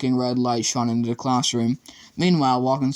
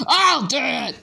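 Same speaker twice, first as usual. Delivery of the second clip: much higher, louder defiant yell; 13 semitones higher, 4 dB louder, slower than usual. A narrator's reading. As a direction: spit it out as a threat. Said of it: "yelled Flowerdew".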